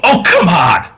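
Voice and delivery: loud, high outburst of frustration; exasperated bodiless voice. Acted. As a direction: cry out like an exasperated ghost. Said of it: "hear this bodiless voice go".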